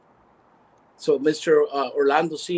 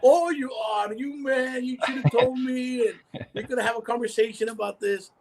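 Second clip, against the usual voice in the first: retold excited surprise; 11 semitones higher than usual. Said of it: "I was like".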